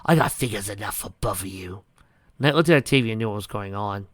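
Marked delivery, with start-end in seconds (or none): 0.0-1.8 menacing whisper. Acted. Melody continues threat.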